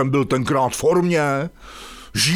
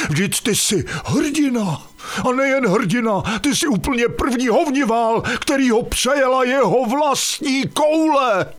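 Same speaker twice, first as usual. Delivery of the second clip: gushing praise; 9 semitones higher than usual. Acted. Wife praising husband effusively.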